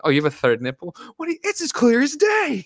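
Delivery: high, loud mock astonishment; shocked disbelief. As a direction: act floored by the obvious. Mocking Brody's shock at the nipple.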